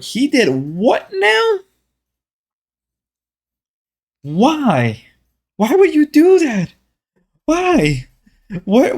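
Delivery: high-pitched, drawn-out incredulous reaction; baffled, high mock questioning; acted disbelief. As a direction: replay your shocked reaction, pitch jumping way up. Acting out shocked reaction to news.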